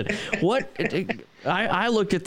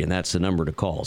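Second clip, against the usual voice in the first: normal talking voice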